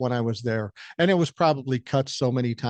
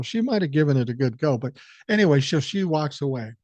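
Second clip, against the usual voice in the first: usual speaking voice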